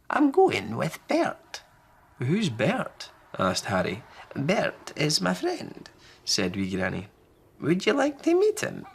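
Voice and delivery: put-on a cheerful little old lady; high sing-song granny; wee Granny. Granny about Bert.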